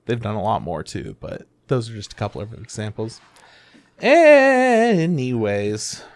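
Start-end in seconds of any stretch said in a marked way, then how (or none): 4.0-6.0 first syllable of "Anyways" stretched very long, high then falling; playful, loud, long-held "Anyways"; drawn-out segue. Real, not acted. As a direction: stretch "Anyways" out to change the subject. Jokey change of topic.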